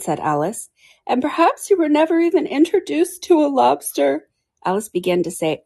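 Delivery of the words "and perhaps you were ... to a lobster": high-pitched creature voice; Mock Turtle, earnest. A narrator's reading.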